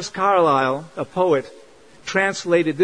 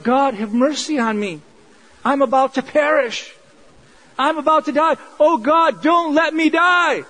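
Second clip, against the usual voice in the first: desperate plea; 7 semitones higher than usual. Retold or imitated. Jonah inside the fish begging God not to let him die, rising plea. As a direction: beg God for your life, higher and higher. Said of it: "he's praying a prayer of".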